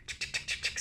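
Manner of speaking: rapid voiceless shutter clicks; imitated camera clicks. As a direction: click like a row of press cameras. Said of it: "all these photographers are just going".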